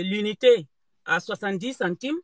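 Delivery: usual speaking voice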